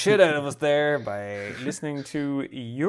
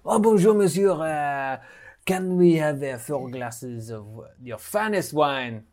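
higher, louder, with a put-on French accent; hammed-up French accent; 3 semitones higher, 4 dB louder, slower than usual. Ordering wine from a waiter, in a hammed-up French accent. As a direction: play a fawning French diner with a thick French accent. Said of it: "I saw Rob's face going".

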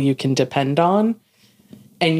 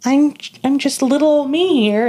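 much higher, slow, timid little-me voice; small meek voice; 10 semitones higher, 3 dB louder than usual. Retold or imitated. Cohost imitating a junior in a managers' meeting, high and timid. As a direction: shrink yourself into a nervous junior. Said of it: "And you're like".

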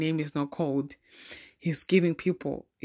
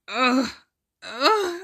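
dramatic sigh; 6 semitones higher, 5 dB louder than usual. Retold or imitated. Remembering boyfriend in class.

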